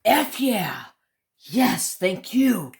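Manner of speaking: mock grateful customer reaction; imitating relieved customer. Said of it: "he was like".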